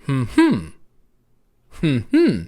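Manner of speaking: hummed "Hmm, hmm" as hm-HMM; short first hum, strong second; second-syllable stress pattern. Shown as a demonstration. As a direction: hum the beat weak-STRONG. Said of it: "How about the second syllable?".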